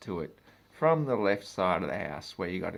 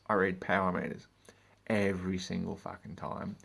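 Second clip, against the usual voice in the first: usual speaking voice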